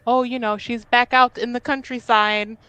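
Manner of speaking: airy, mocking cover story; breezy put-on tone mocking the official story; mock excuse. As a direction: toss off the family's flimsy excuse. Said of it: "if she's like".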